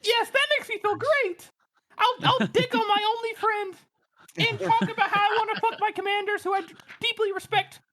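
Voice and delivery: very high, squeaky put-on falsetto; tiny little voice. Host mocking the repressed letter writer, tiny high falsetto. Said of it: "in my tiny little voice".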